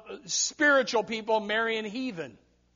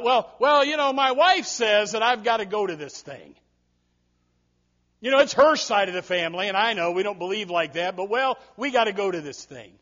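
mock excuse; 6 dB louder than usual. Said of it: "and that's why they were so willing".

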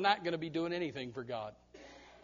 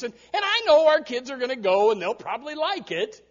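mock excuse; 4 semitones higher, 7 dB louder than usual. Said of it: "Or, or".

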